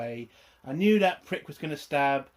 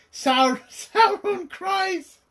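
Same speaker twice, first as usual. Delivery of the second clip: mock crying voice; 7 dB louder, much slower than usual. Mocking Sauron's crying scene.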